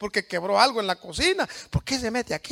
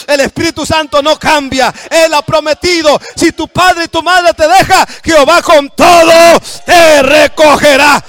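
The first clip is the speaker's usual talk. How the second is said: loud, fervent shouted sermon with rising cries; full-force preaching; 6 semitones higher, 15 dB louder than usual. Acted. Pastor rousing the congregation to praise God, full-force preaching. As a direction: preach it out to the whole church at full voice.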